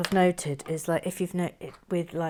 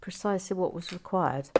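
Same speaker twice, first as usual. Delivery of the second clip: normal talking voice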